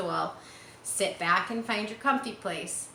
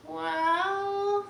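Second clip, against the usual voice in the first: high, drawn-out thoughtful "Well"; 12 semitones higher than usual. Acted. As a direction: let the "Well" hang while she thinks. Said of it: "Fern thoughtfully".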